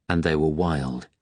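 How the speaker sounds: ordinary delivery, nothing marked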